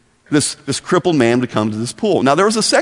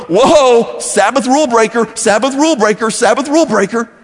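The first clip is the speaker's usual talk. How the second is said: mocking religious leaders; 7 semitones higher than usual. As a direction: jeer it like a playground chant. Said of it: "and they go".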